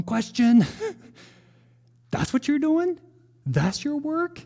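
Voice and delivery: high, mock-incredulous questioning of God. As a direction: ask it high and disbelieving, half laughing.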